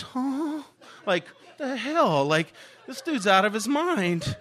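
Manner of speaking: high, drawn-out mock bewilderment; baffled kids. Classmates reacting to a wild new kid, baffled. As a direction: imitate stunned classmates, slow and high.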